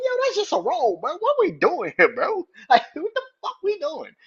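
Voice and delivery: high, loud, choked-up pleading; a fan moved close to tears, crying out at the screen; emotional disbelief. Retold or imitated. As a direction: plead with the wrestler, half laughing and half overcome. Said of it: "I was like".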